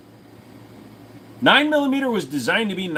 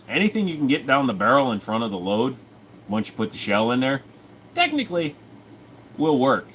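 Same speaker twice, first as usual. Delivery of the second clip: usual speaking voice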